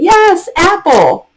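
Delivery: sing-song praise to a young learner, a little hammed-up; bright teacher praise. Teacher mocking the repeat-back habit, high sing-song praise. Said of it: "you're not saying".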